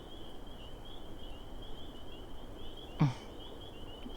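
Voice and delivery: quiet breathy chuckle; soft laugh. Acted. Teasing the partner about real monsters, a soft chuckle.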